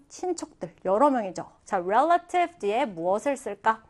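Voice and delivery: ordinary talk, nothing marked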